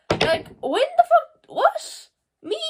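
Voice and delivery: high, slow, squeaky outrage; high incredulous squeak; mock disbelief. Real, not acted. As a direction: play it as if it can't be true. Mock disbelief at being happy.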